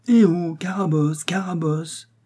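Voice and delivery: high, drawn-out taunting call; jeering children's shout. A narrator's reading. Band of children yelling after a hunchbacked girl, high drawn-out shout. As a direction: call out like a gang of kids. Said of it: "hurlant, criant".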